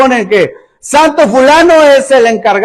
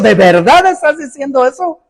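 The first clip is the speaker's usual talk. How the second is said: mock-incredulous question; sarcastic; 3 semitones higher than usual. Preacher paraphrases Jesus challenging his listeners, sarcastic. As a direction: question it as if you doubt they mean it. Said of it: "está hablando de manera sarcástica".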